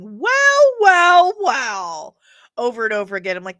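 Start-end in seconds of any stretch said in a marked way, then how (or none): 0.0-2.2 high, loud mock catchphrase, dropping on the last; Grace Randolph. Vlogger imitating a compilation of a grating critic, mocking impression. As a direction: parody the critic's repeated word, starting way up high. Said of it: "compilation of her saying".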